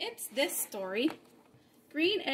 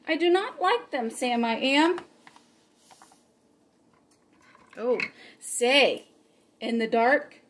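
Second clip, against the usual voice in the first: lively read-aloud with bouncy rhymes; story reading for children; 8 dB louder than usual. A narrator's reading. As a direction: read the rhymes to the kids with fun. Reads Green Eggs and Ham aloud.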